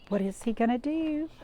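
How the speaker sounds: usual speaking voice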